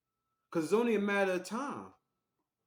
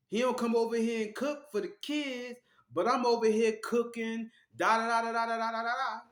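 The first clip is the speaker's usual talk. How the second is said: high, mocking whiny voice; mocking the wife; 6 semitones higher than usual. Man mocking his girlfriend's nagging, higher pitched. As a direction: mimic her going on and on.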